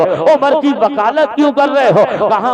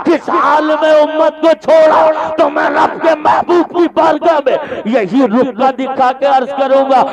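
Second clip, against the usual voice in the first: fiery preaching; 4 semitones higher than usual. Acted. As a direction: preach it at full force, rising to a cry. Preaching climax. (پورے جوش سے بلند آواز میں خطابت)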